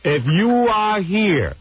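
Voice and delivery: slow, high, drawn-out call over the intercom; intercom announcement. Retold or imitated. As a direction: announce it slowly to the whole house. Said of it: "this is through the intercom".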